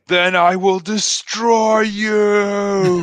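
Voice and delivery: hammed-up villain threat, high and loud; slow, drawn-out mock-menacing roar; movie villain. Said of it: "he has to say".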